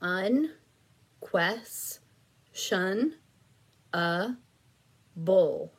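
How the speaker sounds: drawn out syllable by syllable, very slow; split into syllables. Shown as a demonstration. Teacher sounds out syllables for students to blend, very slow. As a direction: stretch every syllable apart for the child. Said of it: "here's our next one".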